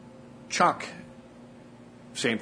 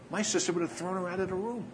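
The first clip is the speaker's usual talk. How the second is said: amazed disbelief; 9 semitones higher, faster than usual. Retold or imitated. Steve amazed at sister's prayer.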